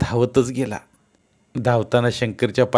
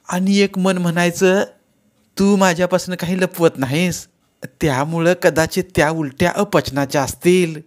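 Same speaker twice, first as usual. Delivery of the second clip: higher, louder mother-in-law, mock reproach; fond scolding mother-in-law; 7 semitones higher, 4 dB louder than usual. Mother-in-law chiding Yamuna fondly, higher and louder. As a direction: chide her fondly for hiding the news.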